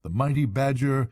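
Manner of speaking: plain reading voice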